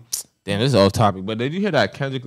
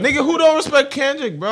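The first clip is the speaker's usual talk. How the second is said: raised, high indignant outburst; heated protest; 13 semitones higher, 7 dB louder than usual. Real, not acted. Defending himself to a friend over a rapper, heated protest. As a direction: push back loudly, pitched up.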